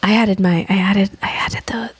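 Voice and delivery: playful hushed aside; ASMR whisper. Shown as a demonstration. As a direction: confess it in a whisper. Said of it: "A little A S M R".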